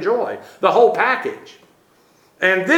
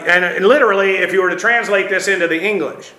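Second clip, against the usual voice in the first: ordinary delivery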